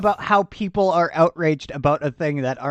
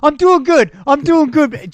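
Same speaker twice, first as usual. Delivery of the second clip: put-on higher, louder, gleeful drunk after a swig; louder, higher mock drunk bum after chugging; 9 semitones higher, 6 dB louder than usual. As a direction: ham up a satisfied drunk. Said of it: "chugs down the alcohol".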